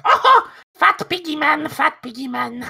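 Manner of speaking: high, slow taunting goblin; sing-song goblin mockery. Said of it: "it's just like".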